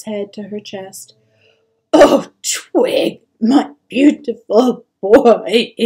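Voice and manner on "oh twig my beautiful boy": loud, slow, heaving sobs; mother weeping over her child; sobbing. A narrator's reading. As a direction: sob the words out at full force. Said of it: "she sobbed".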